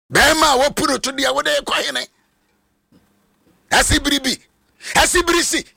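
fired-up sermon shout; full-force preaching. Acted. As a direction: preach it out to the whole church.

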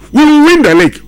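high, loud, emphatic boast; confident Luis Enrique. Retold or imitated. Pundit mimics the PSG coach, loud and confident. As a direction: declare the title win loud and sure. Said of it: "He was so, so confident".

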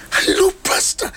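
high, breathless "Hello, pastor" like an eager fan; excited greeting. Retold or imitated. A lady spotting her pastor, excited high greeting. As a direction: squeal it like a thrilled admirer. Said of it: "Ɔyɛ excited".

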